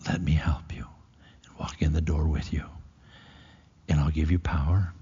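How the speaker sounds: hushed whisper of an offer. Acted. Preacher voicing God promising to walk with them, whispered.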